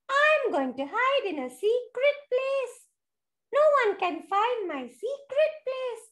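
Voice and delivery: high-pitched little bird character; birdie voice. A narrator's reading. Birdie announcing its hiding place, high little voice. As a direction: pipe it up high as a tiny bird. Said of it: "said the birdie".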